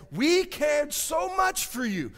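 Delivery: loud, high, slow shouted emphasis; shouted verse. Shown as a demonstration. Preacher shows Paul's care to the congregation, shouting slowly. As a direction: shout each word to make them feel it. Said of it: "if we did care, this is what we would do".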